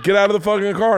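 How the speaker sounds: high-pitched retold holler; mock angry order. Kids' brother at the locked car, mock angry order. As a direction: imitate an enraged man demanding. Acting out the furious older brother.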